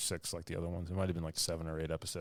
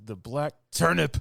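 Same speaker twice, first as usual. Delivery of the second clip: mock announcement; 8 semitones higher, 9 dB louder than usual. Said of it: "He's like, he's like".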